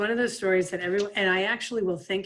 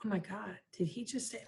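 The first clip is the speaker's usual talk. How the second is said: hushed, much softer disbelief; stifled disbelief; 11 dB quieter than usual. Retold or imitated. Players hiding laughter from coach, hushed disbelief. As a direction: gasp it under your breath. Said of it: "And we're like".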